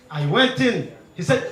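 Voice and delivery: ordinary talk, nothing marked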